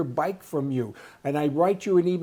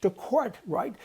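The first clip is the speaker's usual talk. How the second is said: pitched up in disbelief; to court, really?; incredulous; 7 semitones higher, slower than usual. Real, not acted. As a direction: echo it back, amazed. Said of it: "taking the University of B C to court".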